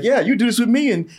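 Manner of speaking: mock Michael voice. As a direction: coax him like a con man.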